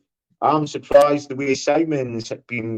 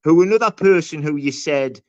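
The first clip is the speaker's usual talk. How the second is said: heated accusation; 6 semitones higher than usual. Real, not acted. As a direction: press him hard, indignant. On-air quarrel with co-host.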